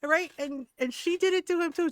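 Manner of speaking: normal talking voice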